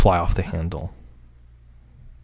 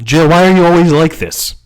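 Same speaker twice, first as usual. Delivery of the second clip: louder, higher mock-exasperated outburst; exasperated scolding; 7 semitones higher, 5 dB louder than usual. Reader scolding Jill for always being this way, exasperated. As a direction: scold in mock despair, pitch swelling up.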